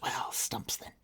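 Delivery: a deadpan whispered correction; breathy whisper, no voice; whispered aside. Acted. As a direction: whisper it, offhand and dry. Magician's offhand correction of Penta.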